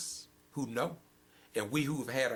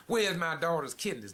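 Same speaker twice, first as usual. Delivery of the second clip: higher, louder pleading cry; a bereaved parent's raised demand; anguished cry; 5 semitones higher, 5 dB louder than usual. Acted. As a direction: cry out like a parent robbed of a child. Grieving parent asking in sermon.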